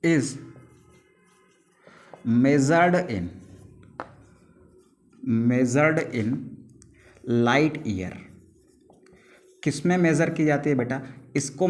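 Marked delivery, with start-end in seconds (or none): 0.0-8.1 slow, spaced dictation; slow classroom dictation; dictation pace. Shown as a demonstration. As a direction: read it out word by word for notes. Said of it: "teacher dictating definition slowly".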